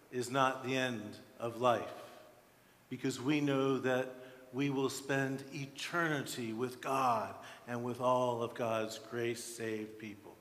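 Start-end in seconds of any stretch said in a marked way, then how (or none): none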